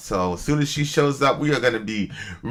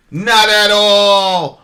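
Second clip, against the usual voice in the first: slow emphatic; 4 semitones higher, 6 dB louder, much slower than usual. Acted.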